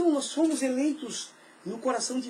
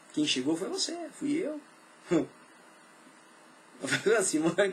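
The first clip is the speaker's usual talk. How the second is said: ordinary delivery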